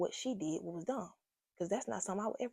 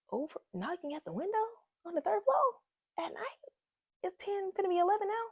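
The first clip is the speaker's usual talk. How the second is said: pitched way up in disbelief; high, outraged disbelief at the hour; incredulous; 13 semitones higher than usual. Retold or imitated. Telling listeners about the late-night knock, pitched-up disbelief.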